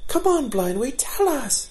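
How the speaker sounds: put-on high, eager young fawn begging; high eager child voice. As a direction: plead like an excited youngster. Eager fawns begging for story.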